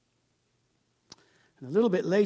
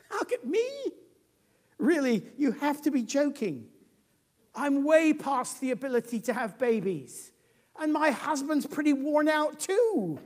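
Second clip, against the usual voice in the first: higher, amused disbelief; an old woman laughing off the news; incredulous Sarah; 8 semitones higher, 4 dB louder than usual. Acted. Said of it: "she laughs silently to herself".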